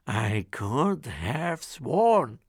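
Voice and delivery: slow, puzzled giant grumble; ogre muttering. Acted. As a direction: doubt himself out loud. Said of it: "he would mutter".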